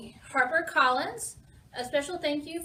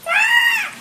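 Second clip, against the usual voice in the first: piercing high parrot screech; loud squawking scream; parrot's cry; 14 dB louder than usual. A narrator's reading. Parrot as the wave crashes down, shrieking squawk. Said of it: "cries the parrot".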